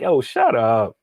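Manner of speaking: teasing, joking brush-off; playful shut up. Shown as a demonstration. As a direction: say it as friendly banter. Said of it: "it's how you say it".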